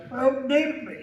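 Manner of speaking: put-on "Oh, baby" crooned high and laughing; first Micah voice. Shown as a demonstration. Actor demos his rejected first Micah voice, a high laughing croon. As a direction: croon it like a smooth-talking outlaw. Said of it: "a little sort of sound like this".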